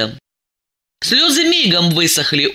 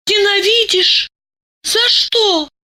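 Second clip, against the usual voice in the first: very high, shocked question; can't believe what she hears; stunned disbelief; slower than usual. Acted. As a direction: Masha stunned by her friend's hatred, very high. Masha stunned by accusation.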